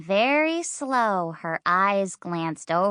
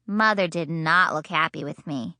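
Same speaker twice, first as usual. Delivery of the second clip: ordinary delivery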